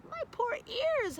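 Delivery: a dog wincing at sirens; mild howl. Acted. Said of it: "howled Cavendish".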